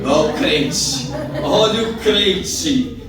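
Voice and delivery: loud, slow, drawn-out taunt; jeering call. Retold or imitated. Heckler singling out the believer, jeering. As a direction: point out the believer in a mocking shout.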